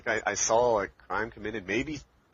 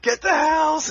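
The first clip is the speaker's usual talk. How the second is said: high, loud retold war cry; rallying shout; 8 dB louder, slower than usual. Girl leading the crowd to the house, rallying shout. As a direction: yell it like a ringleader urging a crowd on. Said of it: "And she's like".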